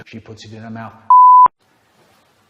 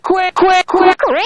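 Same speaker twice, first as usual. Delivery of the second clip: much higher, louder quick quick; frantic high urging; 7 dB louder, slower than usual. Acted. Urging someone to hurry, high frantic cries. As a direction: urge them on in a panic.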